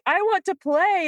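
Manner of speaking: the dog finally begs to play; hammed-up dog asking to play; eager dog. Dog voiced begging to play.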